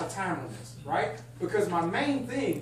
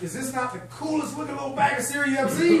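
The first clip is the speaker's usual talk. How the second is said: higher and louder, showing off the bag; excited; 7 semitones higher, 4 dB louder than usual. Real, not acted. Presenter showing trainees the sample bag, thrilled. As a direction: gush over a little cereal bag. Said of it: "Sorry, I'm getting excited".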